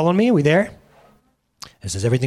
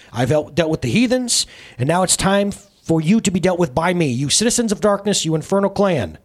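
commanding proclamation; 7 semitones higher, 4 dB louder than usual. Acted. Jesus commanding from the cross.

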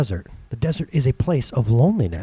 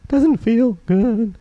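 mock whine; 7 semitones higher, much slower than usual. Voicing a doubting believer.

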